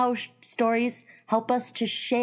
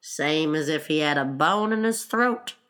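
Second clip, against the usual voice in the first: low-pitched creature voice; Gryphon character voice; 5 semitones lower than usual. Acted. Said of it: "said the Gryphon".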